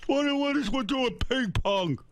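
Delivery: high, slow, drawn-out hollering; berserk dad impression. Father berserk over a Father's Day card, high strained yell. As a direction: imitate Dad going berserk. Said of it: "He went berserk on me".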